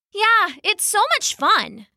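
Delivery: high, slow, gushing agreement; enthusiastic agreement. A narrator's reading. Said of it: "Isabella agreed".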